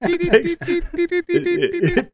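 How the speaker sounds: put-on high, laughing old Yiddish man; cracked, giggly Yiddish grandpa. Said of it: "the old Yiddish voice comes out".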